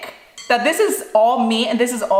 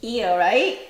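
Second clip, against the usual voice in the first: tender teasing; 5 dB quieter than usual. Real, not acted. Teasing child, rubbing sweat.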